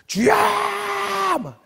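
high, long-drawn cry for mercy; acted desperate cry, stretched and high-pitched; all-out crying out. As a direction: wail it out to someone far off. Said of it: "있는 대로 소리를 질렀어요".

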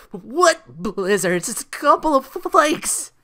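high, scoffing, drawn-out brush-off; mocking dismissal. [name] scoffing at the blizzard.

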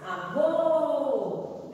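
Avô with a long held VÔ; stress on last syllable. Shown as a demonstration. Teacher showing the strong syllable of Avô, VÔ stretched. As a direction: draw out the final "vô" of "Avô". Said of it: "é o Vô".

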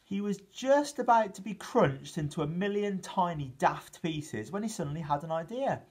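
plain reading voice

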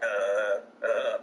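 lightly mocked low video game babble; low cartoon game grunts, imitated; Banjo-Kazooie voice. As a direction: imitate low mumbled game-character noises. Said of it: "Banjo-Kazooie Undertale voice where it's like".

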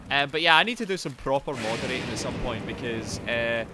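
ordinary talk

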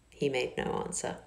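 ordinary delivery

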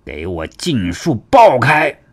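slow, forceful battle command; drawn-out warrior's shout in a put-on voice. A narrator's reading. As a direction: roar the order out, drawing each word long. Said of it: "唐庆喝道".

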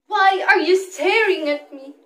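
indignant outcry. Acted. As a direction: cry out at those who stare. Child confronts staring crowd.

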